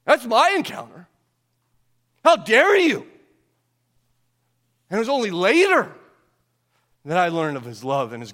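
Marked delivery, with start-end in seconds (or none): 2.2-3.1 higher, louder angry "How dare you", hitting "dare"; angry rebuke. Retold or imitated. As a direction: bark it like a furious God. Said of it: "put a finger in my face and said".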